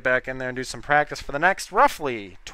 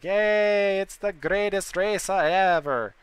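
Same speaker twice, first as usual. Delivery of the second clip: mock fan; 7 semitones higher, slower than usual. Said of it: "coming here and being like".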